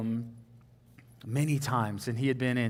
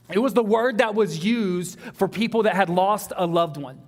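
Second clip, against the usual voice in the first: loud, high, driving preaching; full-force sermon voice; 8 semitones higher, 8 dB louder than usual. Acted. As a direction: preach it hard to the room.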